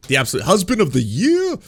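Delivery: mocking the idea he's a great husband, sarcastic; sarcastic praise. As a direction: oversell it so the sarcasm drips.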